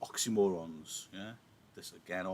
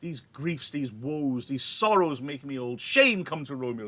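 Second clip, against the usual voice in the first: loud, higher, lamenting recital; dramatic Shakespeare reading; 6 semitones higher, 9 dB louder than usual. Acted. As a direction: wail the Nurse's grief out loud. Teacher reads the Nurse's lines.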